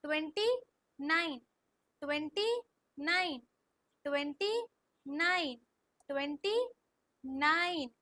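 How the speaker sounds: Twenty rising, pause, falling nine; slow repeat-after-me drill. Shown as a demonstration. Teacher has pupils repeat along, slow chanting drill. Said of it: "slow slow बोलो पहले".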